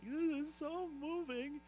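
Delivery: high, slow, mock-sentimental sigh; mock moved. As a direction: gush it out half-teasing.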